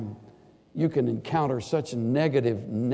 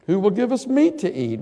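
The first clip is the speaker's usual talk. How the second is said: whining complaint; 8 semitones higher, 3 dB louder than usual. Acted. Quoting whining Israelites.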